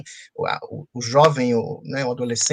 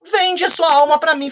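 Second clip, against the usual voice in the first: devil's line higher, louder and slow; hammed-up devil; 11 semitones higher, 4 dB louder, slower than usual. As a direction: ham up the devil's demand, drawn out. Devil offers the deal.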